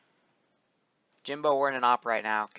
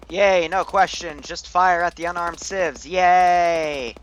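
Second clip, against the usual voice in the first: sarcastic cheer; 6 semitones higher, 7 dB louder, slower than usual. Acted. Cheering friendly fire on civilians.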